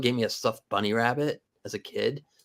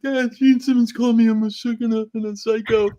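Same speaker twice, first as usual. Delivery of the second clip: put-on upset little kid; 11 semitones higher, 6 dB louder than usual. Kid running crying to dad.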